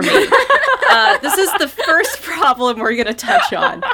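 talking through a laugh; giggling while making a point. Real, not acted. Host cracks up mid-point.

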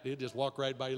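ordinary talk

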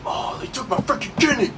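high whiny cartoon unicorn. Quoting Charlie the Unicorn.

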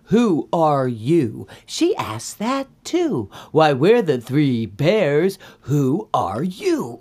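slow, emphatic accusations; drawn-out bear outrage; indignant bears. Acted. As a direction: lay out each charge slowly, with punch.

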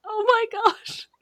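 high, choked-up oh my gosh; emotional gasp. Real, not acted. Fan recalling Marco's cape scene, high emotional gasp. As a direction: let it catch with emotion. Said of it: "I'm getting emotional".

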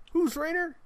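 high, hammed-up surprised question; mock surprise. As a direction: squeak it out in fake disbelief. Mock-surprised radio host question.

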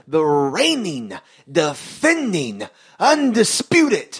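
mock Paul Heyman promo build; Heyman promo cadence. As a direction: stretch each title out like a ring announcer. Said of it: "mimicking Heyman's promo intro".

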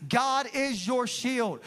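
slowly and deliberately, as a blessing; slow declaration. Real, not acted. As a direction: proclaim it word by word over them. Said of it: "I release over you".

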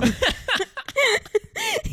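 high loud laughter; laughing hard. Real, not acted. Host laughing at her own story, loud. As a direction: burst out laughing.

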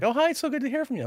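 high, quick, chirpy phone greeting; cheery staff member. Retold or imitated. Recovery staff greeting a former patient, put-on cheeriness. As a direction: gush hello in a put-on high voice.

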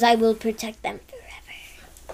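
slow, soft whispered vow. Retold or imitated. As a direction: breathe it out as a secret promise. Doctor's vow in retold scene.

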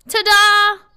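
loud high showman flourish; big bright stage reveal; showy ta-da. Pretend. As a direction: present it like a magic trick. Announcing the reveal.

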